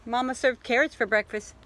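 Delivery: usual speaking voice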